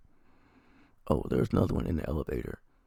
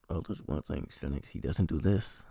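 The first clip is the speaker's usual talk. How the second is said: normal talking voice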